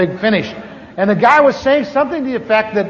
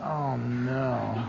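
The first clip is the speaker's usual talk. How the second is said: inner groan; 9 semitones lower, 14 dB quieter than usual. Retold or imitated. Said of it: "I thought".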